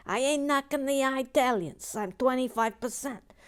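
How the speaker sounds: a hint of a New York lady; Brooklyn accent. Reading Sharon's comment in a light Brooklyn voice. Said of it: "your Brooklyn accent".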